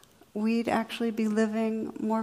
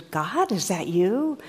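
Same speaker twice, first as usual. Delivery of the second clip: panicked call up; 4 dB louder, slower than usual. Retold or imitated. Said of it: "and he goes".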